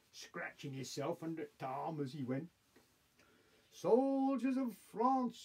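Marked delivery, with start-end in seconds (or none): 3.7-5.4 high, slow oratorical call; proclaiming cry. A narrator's reading. Bonaparte addressing the soldiers of France, crying out. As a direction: hail the troops, drawing out each word. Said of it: "he cried".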